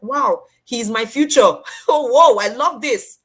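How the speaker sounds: loud, high, delighted gush with a laugh; thrilled. Retold or imitated. The speaker recalls finding her future mentor, loud delighted gushing. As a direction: gush over a dream come true.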